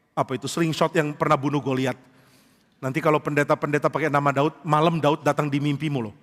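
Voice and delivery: ordinary talk, nothing marked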